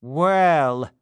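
loud, slow commanding official; pompous beadle. Acted. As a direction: order the boy about. Bumble begins scolding.

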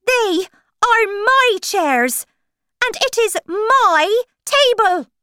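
high, loud, emphatic March Hare; possessive indignation. A narrator's reading. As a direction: stake your claim loudly, word by word. March Hare claims his table.

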